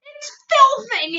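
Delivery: high, loud sputter through a full mouth; spluttering. Acted. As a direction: spit the words out with a full mouth. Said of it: "he spluttered".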